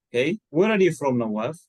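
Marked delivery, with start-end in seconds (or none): none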